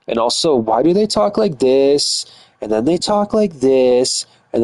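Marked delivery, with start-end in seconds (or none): 0.5-2.2 slow, mock girly drawl; imitated girl talk. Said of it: "I don't know why girls talk like this". 2.7-4.3 mock girly drawl, words stretched; imitated girl talk. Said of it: "I don't know why girls talk like this".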